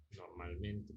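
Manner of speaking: Normalmente dropped low and soft as an afterthought; quiet aside. Shown as a demonstration. Teacher to class, repeating Normalmente softly under the breath. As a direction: murmur Normalmente back to yourself.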